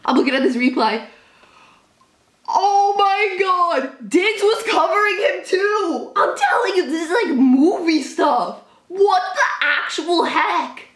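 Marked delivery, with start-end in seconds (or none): 2.5-10.8 high, slow, laughing, teary disbelief; overwhelmed awe. Real, not acted. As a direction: draw it out, near tears of joy. Watching the replay, overwhelmed.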